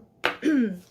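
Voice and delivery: throat clear, loud and rough. Real, not acted. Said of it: "Sorry, losing my voice".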